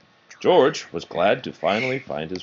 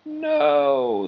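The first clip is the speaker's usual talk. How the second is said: dramatic no; 4 dB louder than usual. A narrator's reading. Narrated crash about to happen.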